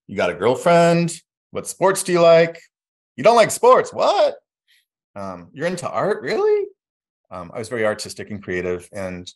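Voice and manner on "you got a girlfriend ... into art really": high, loud mocking relatives' questions; nosy relatives. Relatives grilling the artistic boy, high and loud mockery. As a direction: squeal each nosy question higher. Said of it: "a lot of questions".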